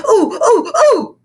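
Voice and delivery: high, loud eager "ooh, ooh"; a kid straining to be picked; eager grunts. Acted. Boy begs teacher to choose him, loud and high. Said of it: "grunted Ham".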